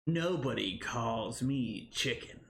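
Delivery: a lightly voiced tough-guy movie line, slow; half-hearted go at the famous line, low and slow; a hint of a movie hero, drawn out; movie line impression. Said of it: "I can't do the accent".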